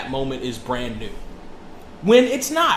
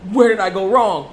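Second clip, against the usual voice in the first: higher, louder mock lament; exasperated mother; 6 semitones higher, 7 dB louder than usual. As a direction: cry it out like a despairing mother. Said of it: "She was like".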